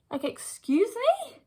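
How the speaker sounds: high, slow "excuse me?" in disbelief; incredulous scoff; indignant. Real, not acted. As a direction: let the outrage rise as you drag it out. Indignant aside about the other girl.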